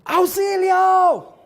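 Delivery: high, loud cry for help mid-fall; grito de auxilio. Retold or imitated. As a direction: yell it out as you tumble down the slope. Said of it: "empieza a gritar". (grito agudo y fuerte pidiendo auxilio)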